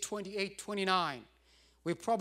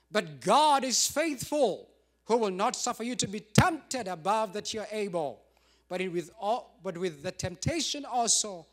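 preaching scripture; 6 dB louder than usual. Real, not acted. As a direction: proclaim the verse to the church. Preaching scripture at full force.

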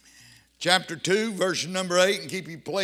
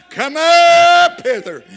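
much higher and louder, slow booming call; heavenly shout; 11 semitones higher, 15 dB louder, much slower than usual. Retold or imitated. Preacher voicing the shout from heaven, booming and slow. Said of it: "I'm listening for a shout out of heaven".

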